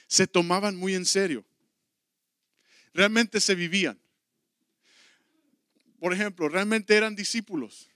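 fervent sermon delivery; preaching. Acted. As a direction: preach it out to the church. Preaching to the congregation.

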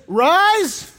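high, loud hammed-up faith healer proclamation; mock faith healer. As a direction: boom it like a TV preacher healing the sick. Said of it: "with a big show".

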